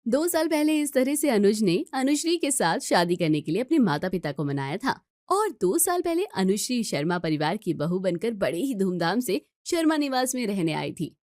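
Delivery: ordinary delivery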